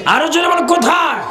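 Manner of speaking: high-pitched rallying call; calling out to the crowd. Real, not acted. Preacher urging crowd to respond.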